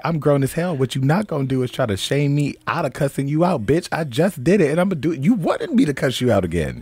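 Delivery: retold telling-off. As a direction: lay into her, half-acted. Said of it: "I'm sitting there like".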